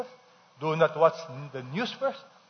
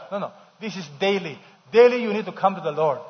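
ordinary delivery

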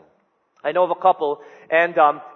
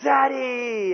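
put-on kids shouting; 6 semitones higher than usual. Sons answering their father at bedtime, shouting happily. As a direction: cheer it out like eager little boys. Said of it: "they all go".

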